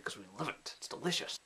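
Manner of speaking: hushed mock rapture over gravy; whispered mock tearful; mock emotional whisper. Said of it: "I got really emotional".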